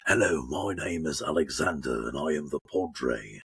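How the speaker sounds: deep trailer voice with English accent; Alexander the Padre. Shown as a demonstration. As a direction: introduce yourself in a deep, grave English accent. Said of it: "So it's like I combine the two".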